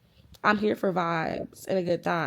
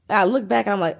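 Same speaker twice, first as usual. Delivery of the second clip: ordinary delivery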